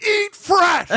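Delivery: high-pitched shouted slogan; sudden shout. Acted. Friend showing the prank at the register, shouting. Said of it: "just be like".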